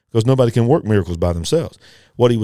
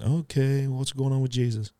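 quiet, higher, puzzled aside; thinking to himself; 4 semitones higher, 8 dB quieter than usual. Acted. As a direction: voice a baffled thought under the breath. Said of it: "Peter's thinking".

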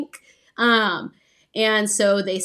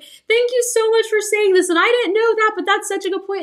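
much higher, louder gushing thanks; gushing classmate; 11 semitones higher, 5 dB louder than usual. Retold or imitated. Classmate thanking her after class, high excited gushing. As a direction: imitate a thrilled classmate gushing. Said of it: "someone was like".

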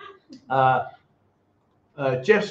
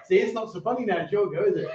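normal talking voice

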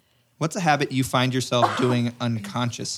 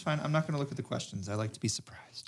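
unvoiced whispered aside; whispering; 17 dB quieter, faster than usual. Real, not acted. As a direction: whisper it as a secret. Teasing aside, very soft.

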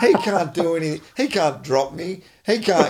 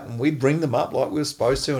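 usual speaking voice

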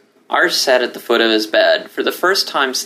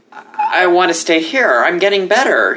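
pleading; 8 semitones higher than usual. Acted. Pleading not to leave.

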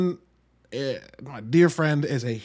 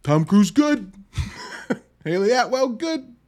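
higher, louder mock parrot of his friend; imitating Kevin; 9 semitones higher, 5 dB louder than usual. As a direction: parrot the friend's verdicts with a laugh. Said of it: "And you know what he did?".

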